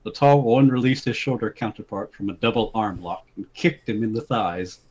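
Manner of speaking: ordinary delivery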